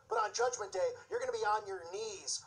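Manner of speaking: normal talking voice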